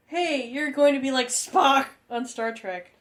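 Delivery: put-on high, slow excited kid voice; a little kid gushing about Star Trek. A student gushing to the teacher in a high, slow kid voice.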